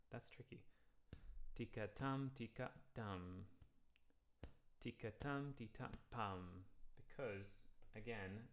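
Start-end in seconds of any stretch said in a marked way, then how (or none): 1.5-6.6 spoken rhythm tika tam; rhythm syllables. Shown as a demonstration. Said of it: "second syllable of water".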